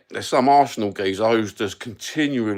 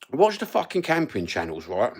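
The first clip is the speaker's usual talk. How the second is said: ordinary talk, nothing marked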